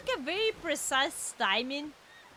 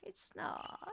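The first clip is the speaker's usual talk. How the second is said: very soft, near-whispered words; hushed denial; 3 semitones higher, 15 dB quieter, much slower than usual. Real, not acted. Gamer after a terrifying moment, hushed whisper. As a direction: drop to a faint whisper.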